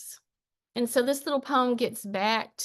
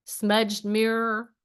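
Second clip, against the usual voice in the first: spaced-out verse, phrase by phrase; unhurried spoken-word recital; poem reading; slower than usual. Acted. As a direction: linger on each image, pause between them. Reads own poem aloud.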